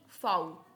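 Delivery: German V named फाउ, f sound first; German letter V. Shown as a demonstration. Teacher drilling German V, फाउ as slow "fow". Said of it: "नहीं बोलेंगे".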